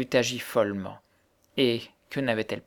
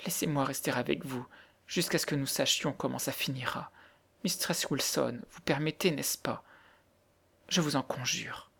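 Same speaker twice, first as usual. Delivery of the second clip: hushed, near-whispered entreaty; soft pleading; 10 dB quieter than usual. A narrator's reading. Girl begging the widow to let her stay, soft pleading. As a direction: beg her very softly to let you stay. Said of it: "répétait d'une voix suppliante".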